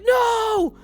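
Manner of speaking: high, loud, desperate begging scream; pleading shout. Retold or imitated. Player begging Lost One to spare trainees, shrieked high and loud. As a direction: shriek it as if begging a companion to stop killing. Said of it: "And I was like".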